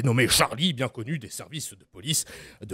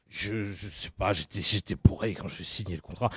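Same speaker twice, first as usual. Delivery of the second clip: [name] impression; 6 semitones lower, 5 dB quieter than usual. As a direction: drag the words out low like a drunk singer.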